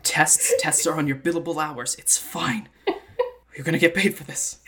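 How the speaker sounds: loud, emphatic mock conviction; frantic self-pep talk. Character reminds himself of billable hours, frantic and loud.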